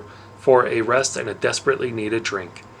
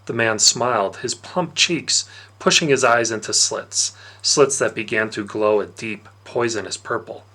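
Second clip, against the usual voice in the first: ordinary delivery, nothing marked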